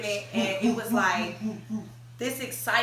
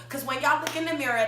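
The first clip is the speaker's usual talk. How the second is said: usual speaking voice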